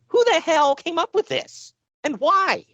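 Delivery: high, slow exasperated question; exasperated disbelief. Retold or imitated. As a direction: squeal it out in disbelief. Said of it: "we're like".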